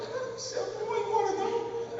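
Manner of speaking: high, soft mock lazy protest; a hint of a lazy churchgoer; lazy refusal. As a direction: dig in your heels lightly.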